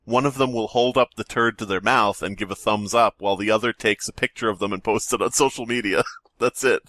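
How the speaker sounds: ordinary talk, nothing marked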